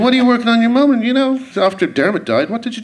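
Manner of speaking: mocking the fan's question. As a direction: parody the condescending asker. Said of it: "the tone of the question".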